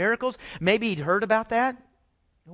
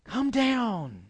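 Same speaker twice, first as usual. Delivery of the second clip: pleading prayer; 4 semitones higher, 6 dB louder, much slower than usual. Retold or imitated. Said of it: "We look to heaven and say".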